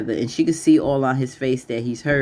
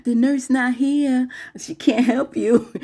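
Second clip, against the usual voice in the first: higher, slow mocking taunt; Maurice taunting; 8 semitones higher than usual. Maurice gloating over Q, higher slow taunt. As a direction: gloat over the helpless man. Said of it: "Maurice like".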